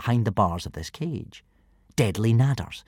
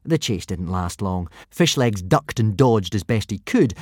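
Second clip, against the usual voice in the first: ordinary delivery, nothing marked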